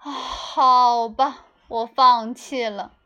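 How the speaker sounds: slow, loud defeated sigh of words; giving up. Acted. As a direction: drag it out in defeat. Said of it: "校长说道".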